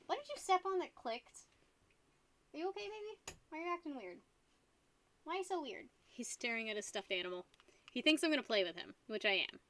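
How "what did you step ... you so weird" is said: pet talk. Acted. Talking sweetly to the dog.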